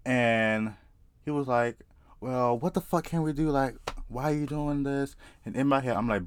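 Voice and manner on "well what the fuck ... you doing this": lightly voiced protesting guy, pitched higher; a higher voice for the other man's complaint; retold protest. Man at the table questioning the dreamer, mock protest.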